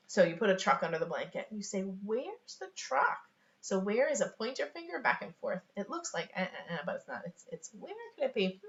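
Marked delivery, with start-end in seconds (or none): 1.8-3.2 high, very slow sing-song question; playful wondering. Acted. Parent hides truck under blanket, playfully wondering. Playing hide-and-find with a child. 7.8-8.6 high sing-song wondering; playful puzzlement. Acted. Parent pretends to search for toy, playful puzzlement. Acting out searching for hidden object.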